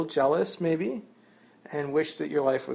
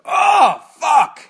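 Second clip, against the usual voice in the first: sudden high yelled curse; pained outburst at a sharp stone; retold curse yell; 13 semitones higher, 14 dB louder than usual. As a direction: yell it like you just stepped on a rock. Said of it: "That's what I do".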